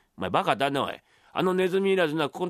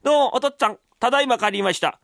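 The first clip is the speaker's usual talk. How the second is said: high, put-on dutiful son voice; mimicked son's greeting, pitched high; imitating the young master; 5 semitones higher, faster than usual. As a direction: call up politely in an imitated voice. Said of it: "俺の声色がうまい".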